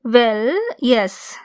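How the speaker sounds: normal talking voice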